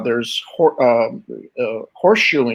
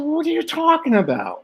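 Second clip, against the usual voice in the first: stunned question; faster than usual. Retold or imitated. Stunned by dad's reveal.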